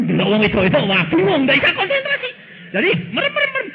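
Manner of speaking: high laughing mockery of a flustered man; mock distracted pastor. Preacher mocking pastors distracted by women, laughing. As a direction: ham up the pastor who cannot focus.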